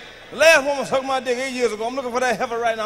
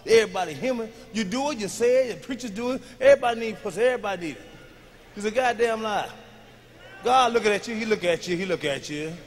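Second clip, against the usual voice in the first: ordinary talk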